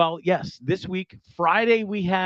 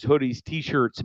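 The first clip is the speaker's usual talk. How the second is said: usual speaking voice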